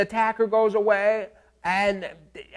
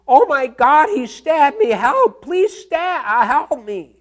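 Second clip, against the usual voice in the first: high, loud panicked cries for help; retold terrified screams; 7 semitones higher, 6 dB louder, slower than usual. Lecturer acting out a victim screaming, panicked and loud. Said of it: "she just screams".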